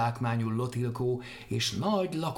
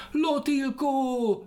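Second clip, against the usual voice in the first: very high, slow, loud call; calling after; 13 semitones higher, 5 dB louder, much slower than usual. Acted. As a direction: stretch Lotilkó out high to the sky. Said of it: "kiabált utána". (Lotilkó nagyon magasan, lassan elnyújtva kiáltva)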